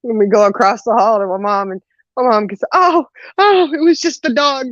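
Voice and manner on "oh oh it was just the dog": imitated startled mom. Imitates mom's startled relief.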